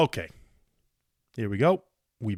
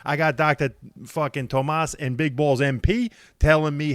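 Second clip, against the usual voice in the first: normal talking voice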